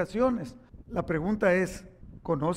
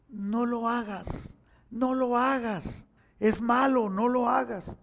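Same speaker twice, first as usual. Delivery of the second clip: lightly voiced angel pleading slowly; a hint of a little angel begging, drawn out; good little angel; 3 semitones higher, slower than usual. Boy voicing the good angel to his father, slow gentle plea. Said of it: "un angelito bueno que me decía".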